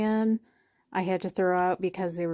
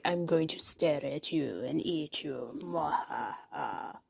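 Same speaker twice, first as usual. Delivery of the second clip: sneering panto villain; 4 semitones lower, 4 dB quieter than usual. Pretend. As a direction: growl it like a creepy doll. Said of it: "It's like".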